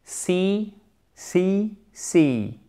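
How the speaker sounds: See said with a long drawn i; long i demo. Shown as a demonstration. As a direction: stretch the i in See, see, see. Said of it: "la différence entre la mère et le verbe voir".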